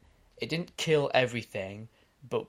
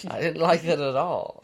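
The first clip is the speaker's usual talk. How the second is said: exaggerated British, higher and louder; higher-pitched British indignation; 5 semitones higher, 6 dB louder than usual. Real, not acted. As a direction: go all British in protest. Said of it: "Why did you go all British?".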